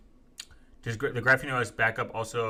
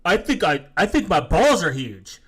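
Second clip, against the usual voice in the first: a hint of a dopey, self-important know-it-all, louder; lightly mock the smug internet guy; mocking a clueless commenter; 4 semitones higher, 8 dB louder, slower than usual. Streamer mocking chat commenters, a loud dopey put-on voice. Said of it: "and they're like".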